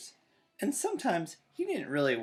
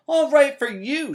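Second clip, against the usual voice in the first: high, loud shriek; 13 semitones higher, 14 dB louder than usual. Acted. Sister left alone, shrieking after brother. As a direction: screech it after him. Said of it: "she shrieked".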